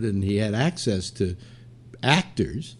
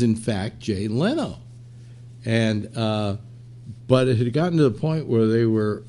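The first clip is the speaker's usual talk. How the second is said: ordinary delivery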